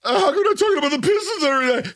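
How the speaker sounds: high, fast nagging caller voice; whiny fan voice. Retold or imitated. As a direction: pester like an annoying fan. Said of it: "you sound like the radio guy".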